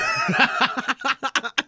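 laugh. Real, not acted. Laughing at friends quoting shows.

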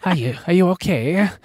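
high mock Qatari accent; exaggerated, high-voiced Qatari imitation; mimicked Qatari accent. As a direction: tease with a put-on Qatari accent. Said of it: "I'll stop doing the accent".

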